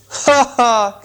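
high hammed-up laugh of discovery; high cackling elf; mock laugh. A narrator's reading. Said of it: "came a cry".

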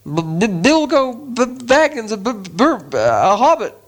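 high, slow, stammering hobbit; terrified stammer. Acted. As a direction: stutter in terror. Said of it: "shaking all over".

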